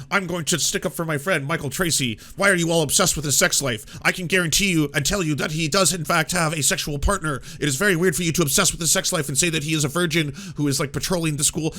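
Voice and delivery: lightly voiced, loud indignant defence; mocking Greenwald. Streamer mocks Greenwald defending his friend, indignant mock voice. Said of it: "Glenn Greenwald comes along and is like".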